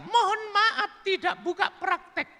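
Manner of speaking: high falsetto announcement; woman's written notice. Acted. Woman's sign on her closed door, high falsetto. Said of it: "Diberi tulisan panjang besar".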